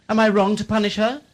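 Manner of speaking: menacing demand. Acted. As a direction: bark the challenge. Keeper demanding an answer.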